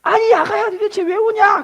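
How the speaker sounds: high, loud motherly question; concerned mother-in-law. Retold or imitated. Mother-in-law asking the crying bride why, high and loud. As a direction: call out to the weeping bride. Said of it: "시어머니가 오셨어요".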